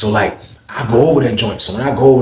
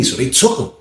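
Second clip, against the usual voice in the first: usual speaking voice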